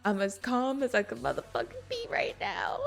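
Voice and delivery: sarcastic mutter. Retold or imitated. Sarcastic reply to Felix, laughing.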